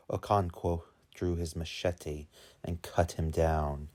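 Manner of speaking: usual speaking voice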